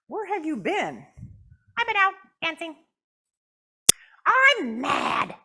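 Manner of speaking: puppet voice. Acted. Puppet Jasmine complains to the counselor in a high voice. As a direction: fuss as a little puppet.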